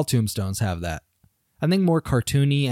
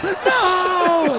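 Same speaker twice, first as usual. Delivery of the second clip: much higher shocked no; high cartoon no; 4 dB louder than usual. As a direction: cry out like a stunned fan. Crowd cry.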